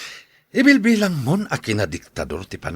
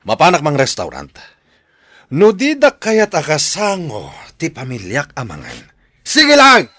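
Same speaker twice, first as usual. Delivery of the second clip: growing louder, ending in a high shouted snap; angry outburst; 6 semitones higher, 7 dB louder than usual. Acted. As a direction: storm off, shouting the last words. Father storms out of family meal. (sipupungtot a pumanaw, agpukpukkaw)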